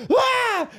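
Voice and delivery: high sharp yelp of pain; mock cry as the shoulder pops; retold pain cry. Guitar teacher reliving the shoulder popping, sharp high yelp. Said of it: "And then I was like".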